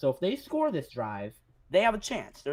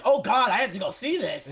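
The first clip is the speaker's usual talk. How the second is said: mock excited; 6 semitones higher, 7 dB louder than usual. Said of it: "I'd be like".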